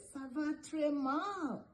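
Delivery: lightly hammed-up misery; pretend unwell. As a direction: groan it slowly as if a headache is pounding. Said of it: "I have a terrible headache".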